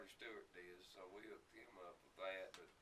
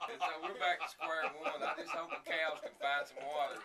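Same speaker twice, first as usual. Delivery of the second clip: much louder, laughing through the words; laughing while talking; 6 semitones higher, 14 dB louder than usual. Real, not acted. Guest laughs loudly about the cows eating the neighbor's corn. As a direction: crack up as you tell it.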